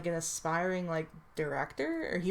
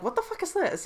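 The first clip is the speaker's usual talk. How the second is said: imitating a much higher, baffled cry at weird titles; a pitched-up, mock-confused what the fuck; retold baffled outburst; 10 semitones higher than usual. Player reacting to strange game titles, high mock bafflement. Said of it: "I'm like".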